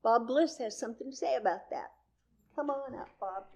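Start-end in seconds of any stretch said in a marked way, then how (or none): none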